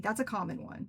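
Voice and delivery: ordinary talk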